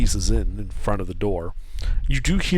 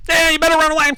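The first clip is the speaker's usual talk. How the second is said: very high, loud goblin jeer; squeaky creature taunt; 7 dB louder, faster than usual. Acted. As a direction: taunt them like a shrieking goblin. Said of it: "you hear the voice call it again".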